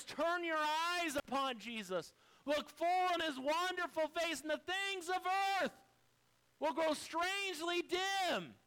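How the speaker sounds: rally the congregation with fervor; full-force preaching. Acted. Pastor urging the church through their storms, fervently.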